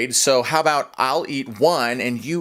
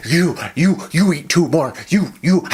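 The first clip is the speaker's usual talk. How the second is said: hammed-up gasping between words; mock agonized panting; 2 semitones higher than usual. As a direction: force out each word through exaggerated pepper-burn panting. Panting in agony after pepper.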